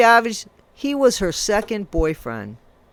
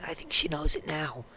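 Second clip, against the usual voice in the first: whisper; 5 semitones lower, 14 dB quieter than usual. Real, not acted. Taunting Jenna about the Miscavige name.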